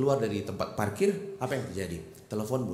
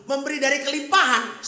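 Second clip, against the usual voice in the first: raised, high preaching voice; preaching loudly; 14 semitones higher, 8 dB louder than usual. Acted. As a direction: proclaim it to the congregation, loud and high. Preacher contrasts giving in sermon.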